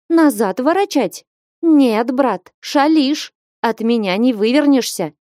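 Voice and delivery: high-pitched, forceful refusal; indignant exclamation. A narrator's reading. Флёнушка won't let Василий Борисыч back out, exclaiming. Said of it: "вскликнула Флёнушка".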